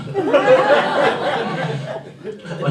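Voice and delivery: short high cackle; high laugh. Real, not acted.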